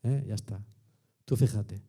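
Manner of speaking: whispered, lazy self-praise. Retold or imitated. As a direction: hush it like patting yourself on the back. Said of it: "tumbarme a la Bartola y decir". (susurro perezoso y complaciente)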